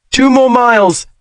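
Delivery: calling over pounding rain; carrying over thunder. A narrator's reading. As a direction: shout it over the storm. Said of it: "had to raise her voice to be heard".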